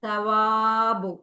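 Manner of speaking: സവാബു very slow, long വാ; drawn-out syllables. Shown as a demonstration. Teacher models the word for the class, drawn-out syllables. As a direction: stretch the വാ in സവാബു. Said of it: "നമ്മൾ വാ".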